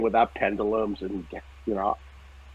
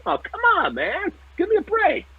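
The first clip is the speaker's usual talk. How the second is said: much higher, whiny mock protest; arguing with Spirit. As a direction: whine it like a kid told to turn the car around. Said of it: "I'm arguing".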